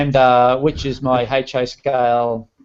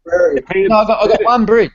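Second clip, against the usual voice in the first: higher and louder, pressing on bridge; emphatic protest; 7 semitones higher, 6 dB louder than usual. Real, not acted. Modeller protesting to the host, louder and higher. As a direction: insist with a laugh in it, bridge punched. Self-mocking protest about scenery.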